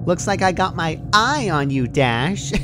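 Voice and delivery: high, sing-song menace with a grin; playful threat from a giggly pony; Pinky's creepy cheer. A narrator's reading. Pinky toying with Dash, creepy sing-song. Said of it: "Pinky giggled".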